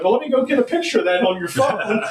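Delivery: gleeful, can't hold back the laugh; excited laughing retelling; laughing eagerly. Retold or imitated.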